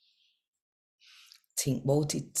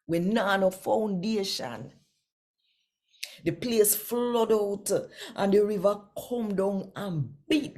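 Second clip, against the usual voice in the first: scripture reading; 4 semitones higher than usual. Acted. Reads scripture passage in Patois.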